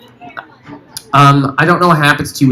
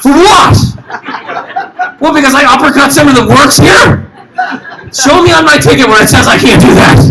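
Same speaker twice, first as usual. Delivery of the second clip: indignant passenger; 8 semitones higher than usual. Acted. Acted passenger confronting air marshals.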